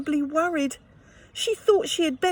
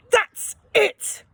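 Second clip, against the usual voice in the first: much higher and louder, a shrill screech; screechy shout; 9 semitones higher, 4 dB louder than usual. Acted. Mum losing her temper at Ellie, a screechy shout. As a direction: snap it out in a screech. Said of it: "she shouted in a really weird, screechy voice".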